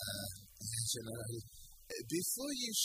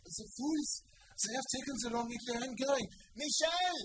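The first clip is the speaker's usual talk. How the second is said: shouting; 11 semitones higher, 5 dB louder than usual. Acted. Panic in the dark café.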